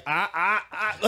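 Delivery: high laughing ah ah ah; silly yelps to shake off a thought; mock startled yelps. Friend jokingly showing his mental reset trick with yelps.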